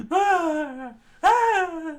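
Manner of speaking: high, loud cartoon scream; crowd scream. Crowd screaming at the pirate, high and loud. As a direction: shriek in panic.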